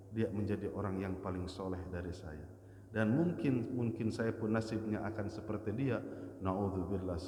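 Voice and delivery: soft humble prayer; humble inner prayer. Acted. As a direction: pray quietly to yourself in humility. Humble prayer voiced for listener.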